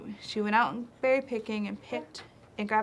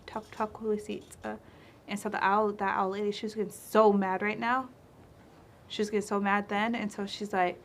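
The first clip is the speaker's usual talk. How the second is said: plain reading voice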